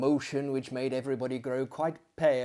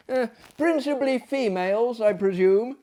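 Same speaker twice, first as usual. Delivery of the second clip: curious gentleman; 8 semitones higher, 4 dB louder than usual. Acted. Narrator character asks.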